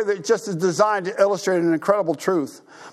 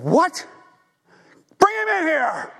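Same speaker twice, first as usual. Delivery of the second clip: high, indignant outcry; shocked, then order him brought in; outraged king; 9 semitones higher than usual. Retold or imitated. King hearing the servants' report, high indignant outcry.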